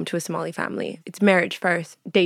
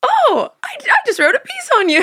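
much higher, louder, breathless with excitement; gushing mom; 6 dB louder than usual. Retold or imitated. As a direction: blurt it out like a starstruck fan. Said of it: "And she's like".

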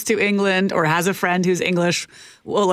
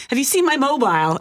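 higher-pitched imitation British accent; mock British affectation; 6 semitones higher than usual. Friend back from the UK, affected British accent. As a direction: lightly mimic a friend's acquired Britishisms. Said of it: "are saying things like".

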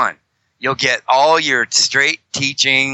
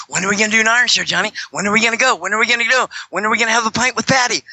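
high, fast pestering voice; imitating an eager friend; 9 semitones higher, much faster than usual. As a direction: nag like an impatient buddy. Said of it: "like, bugging me every other day".

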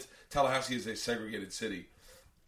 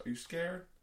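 higher, smiling needle; smiling taunt; 6 semitones higher, 3 dB quieter, slower than usual. Retold or imitated. Man teasing the nervous driver, smiling taunt. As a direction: ask it higher, through a smile. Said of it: "and he's smiling".

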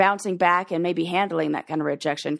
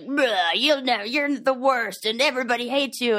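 gross slug monster; 3 semitones higher than usual. Acted. Inner troll insults its host, gross slug voice. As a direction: put on a slimy slug creature's sneering voice. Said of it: "he's, like, a gross slug guy".

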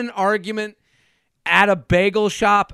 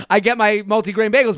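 ordinary talk, nothing marked

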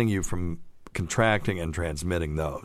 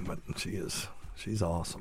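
hushed aside; 10 dB quieter than usual. Real, not acted. Hushing a compliment.

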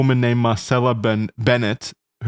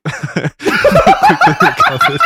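high squeal of laughter through words; laughing; 7 dB louder, much slower than usual. Real, not acted. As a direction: crack up while saying it. Laughing at baby drop.

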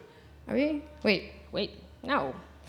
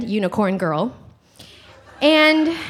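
ordinary delivery

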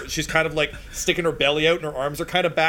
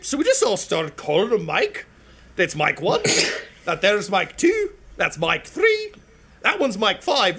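gnome voice; 8 semitones higher, 3 dB louder than usual. Acted. Gnome asking the knight to lift the visor, high. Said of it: "She's like".

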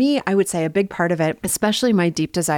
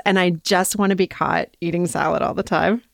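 usual speaking voice

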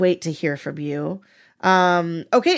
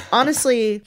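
ordinary talk, nothing marked

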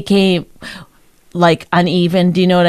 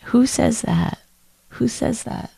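soft, higher-pitched repeated question; soft needling; 3 semitones higher, 5 dB quieter than usual. Real, not acted. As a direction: needle them in a small quiet voice. Repeats question softly to needle partner.

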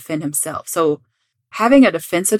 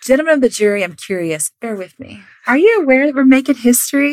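higher, louder courtroom oratory; theatrical trial lawyer; 7 semitones higher, 5 dB louder than usual. Acted. Defense lawyer opens to the jury, grandstanding.

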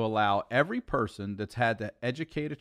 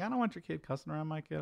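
mock prissy parent; 5 semitones higher, 8 dB quieter, much faster than usual. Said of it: "Other parents will be like".

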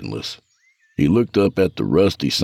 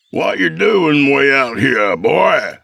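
put-on gruff old man; 9 semitones higher, 4 dB louder, slower than usual. Old father voiced.

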